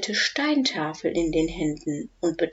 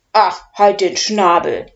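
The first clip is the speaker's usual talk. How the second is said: commanding; 8 dB louder than usual. Acted. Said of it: "befiehlt Udo".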